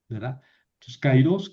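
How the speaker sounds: ordinary talk, nothing marked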